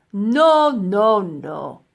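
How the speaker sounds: loud scornful no's fading down; scoffing. A narrator's reading. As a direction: scoff him off. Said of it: "scoffed the man".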